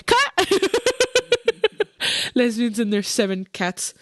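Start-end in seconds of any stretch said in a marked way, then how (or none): 0.1-2.4 high, laughing call to cut. Real, not acted. Host stopping her own risky joke, laughing. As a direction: call it out as laughter breaks through. Joking after an off-colour stereotype.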